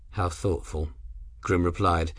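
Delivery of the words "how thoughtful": disinterested tone. A narrator's reading. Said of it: "his tone disinterested".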